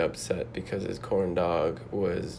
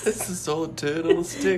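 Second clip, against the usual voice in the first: put-on high, quick rant in a silly Jamestown accent; mock Jamestown accent; 9 semitones higher, faster than usual. Customer complaining about food on a stick, in a mock Jamestown accent. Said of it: "Practicing my Jamestown accent".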